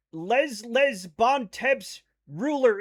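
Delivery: slow, anglicized French in American accent; own American accent. Shown as a demonstration. Streamer reading chat's French, deliberate American accent. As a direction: sound out French by English spelling, American accent. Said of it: "read things in my accent now".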